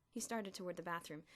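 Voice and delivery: usual speaking voice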